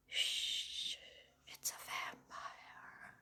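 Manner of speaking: slow, breathy hush; urgent whisper. Retold or imitated. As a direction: shush her in terror. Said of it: "I was like".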